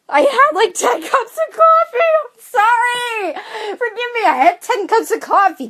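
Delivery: very high, hammed-up whine; mock wailing apology. Hammed-up whiny apology over coffee.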